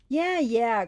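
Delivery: brash teasing call; taunting. A narrator's reading. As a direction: taunt the monster. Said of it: "Clarice taunted".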